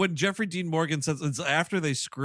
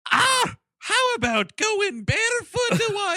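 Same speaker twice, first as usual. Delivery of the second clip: much higher, hammed-up bad Irish accent; a deliberately terrible Irish lilt, pitched way up; Jeffrey Dean Morgan's Irish accent; 4 dB louder than usual. Mocking the actor's line in an over-the-top Irish accent. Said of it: "my Jeffrey Dean Morgan impression".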